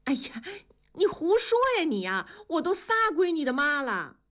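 high, flustered and embarrassed; half scold, half blush; bashful indignation. A narrator's reading. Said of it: "又羞又气". (又羞又气地嗔怪)

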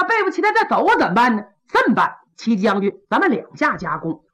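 butter up the general, then refuse; fawning Pan Hu. Acted. Pan Hu's wheedling excuses. (潘虎假意奉承，高声油滑)

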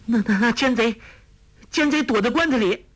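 high, stammering frightened voice; terrified, pitched far up; trembling with fear. A narrator's reading. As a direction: stammer it out in a high, scared quaver. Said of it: "颤声说".

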